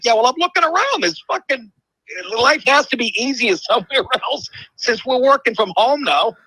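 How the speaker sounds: a high, worked-up rant about escaping; exasperated retort. Retold or imitated. To friends mocking his traffic gripes, a high exasperated retort. Retelling his own complaint, pitched up.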